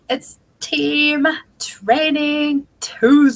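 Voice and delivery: high, slow, drawn-out announcer call; show title announcement. Acted. Host to her team, announcing the weekly show title. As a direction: sing out the show's name like a host.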